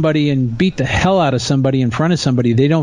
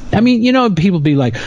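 ordinary delivery

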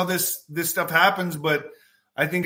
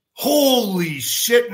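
drawn out slowly, pitched up in amazement; stunned realisation; 6 semitones higher, 4 dB louder, much slower than usual. Retold or imitated. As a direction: let it dawn on you slowly. Suddenly recognising [name].

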